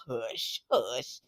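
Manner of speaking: hushed, whispery shush. Retold or imitated. Aunt to niece and nephew over a kiss, shushing. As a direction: shush the kids under your breath.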